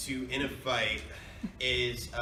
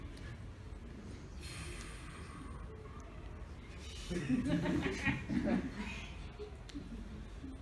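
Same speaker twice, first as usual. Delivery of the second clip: laughing; 9 semitones higher, 9 dB quieter than usual. Real, not acted. Laughing at Luigi joke.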